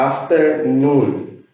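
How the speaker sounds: Afternoon stretched out slowly; slow English model. Shown as a demonstration. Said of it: "Es una palabra formada". (modelo lento de Afternoon)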